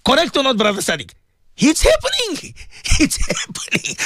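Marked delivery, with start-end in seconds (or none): 1.6-2.5 high, outraged exclamation; exclaimed. Real, not acted. Exclaims about mistreatment of servants. 2.7-4.0 breathy, slow bitter laugh through the words. Real, not acted. Repeats claim through a laugh.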